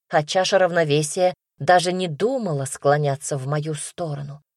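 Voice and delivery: usual speaking voice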